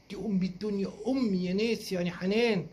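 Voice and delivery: normal talking voice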